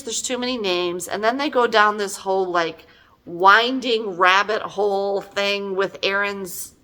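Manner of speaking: usual speaking voice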